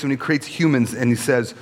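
ordinary delivery

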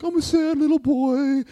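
high, loud whiny mock sad kid; sad little boy. Teen walking to the library, mock whiny sad boy. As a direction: whine it like a moping little kid. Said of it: "I probably walked like this".

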